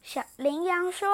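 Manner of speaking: ordinary delivery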